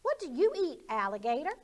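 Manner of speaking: high, loud frog question; nosy frog asking; bouncy high frog voice; curious frog. Acted. Frog asks the alligator.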